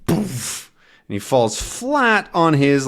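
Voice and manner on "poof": giggly, loopy boast from a tipsy dog; drunk dog voice. Acted. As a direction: show off while drunk. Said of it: "now Snowy says".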